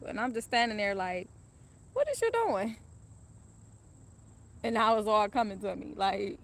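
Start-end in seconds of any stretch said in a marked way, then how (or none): 1.9-2.8 high, loud, shocked what is you doing; shocked question. Retold or imitated. Confronting the gunman who recognised her, shocked high cry. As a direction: blurt it out in disbelief.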